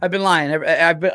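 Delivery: ordinary delivery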